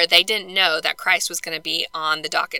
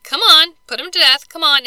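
louder, higher mock goading; imitated urging; 7 semitones higher, 10 dB louder than usual. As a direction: push him impatiently, voicing the leaders.

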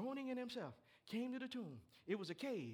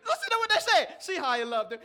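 loud preaching; 7 semitones higher, 17 dB louder than usual. Real, not acted. Preacher shouts the verse.

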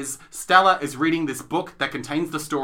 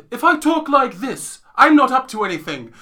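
British accent demo; 7 semitones higher, 6 dB louder than usual. Shown as a demonstration. Host shows co-host how good characters talk, put-on British accent. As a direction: demonstrate a well-behaved fairy-tale British accent. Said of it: "a fairy tale accent".

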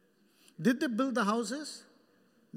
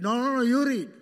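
1 loud refusal; 8 dB louder, faster than usual. Retold or imitated. Wife refusing to read proverb.

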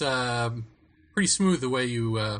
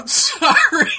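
apology breaking into laughter; stifled giggles; 9 dB louder, slower than usual. Real, not acted. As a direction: say sorry through held-in giggles. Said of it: "Trying not to laugh".

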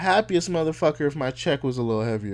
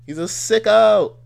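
loud teasing; 10 semitones higher, 11 dB louder than usual. Real, not acted. Co-host teasing the speaker.

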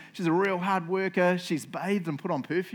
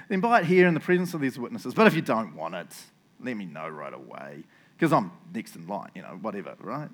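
who-cares nonchalance; 5 semitones lower than usual. Shown as a demonstration. Boaz lets the redeemer decide, nonchalant and fading. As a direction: let it trail down into indifference. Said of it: "I'll read it in the who really cares way".

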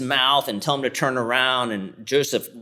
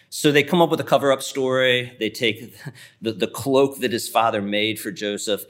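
usual speaking voice

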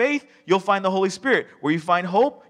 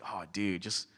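muttered jab; 8 semitones lower, 12 dB quieter than usual. Retold or imitated. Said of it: "you're just like".

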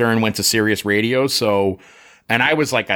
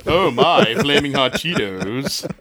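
slow, drawn-out mock Takei pitch line; slow hammed-up George Takei commercial voice; 4 semitones higher, slower than usual. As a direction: ham up George Takei selling Cheetos. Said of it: "Cheetos commercials going".

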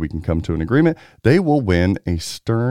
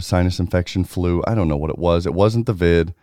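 usual speaking voice

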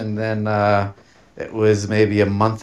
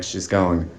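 ordinary talk

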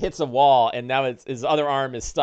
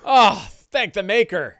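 relieved rancher; 6 semitones higher, 5 dB louder than usual. Acted. Rescued man relieved.

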